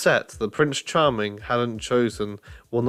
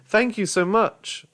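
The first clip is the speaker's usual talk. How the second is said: grateful thanks; 9 semitones higher than usual. Acted. Alex thanks the man.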